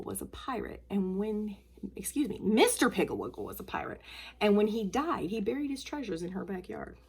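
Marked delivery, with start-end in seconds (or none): none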